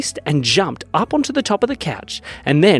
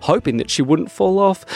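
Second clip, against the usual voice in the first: ordinary delivery, nothing marked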